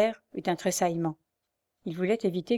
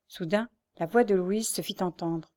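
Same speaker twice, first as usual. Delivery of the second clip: plain reading voice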